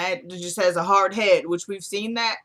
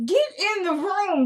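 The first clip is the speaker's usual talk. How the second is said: high, insistent command; exasperated order; 11 semitones higher than usual. Real, not acted. Sending Jasmine to the room, exasperated. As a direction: order it firmly in a raised pitch.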